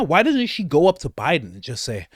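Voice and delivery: ordinary delivery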